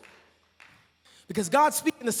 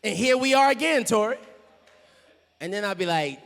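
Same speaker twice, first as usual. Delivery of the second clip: normal talking voice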